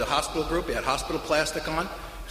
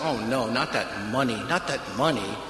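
mock dismay; 7 semitones lower, 4 dB quieter than usual. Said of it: "I just went".

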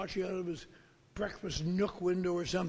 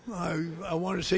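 usual speaking voice

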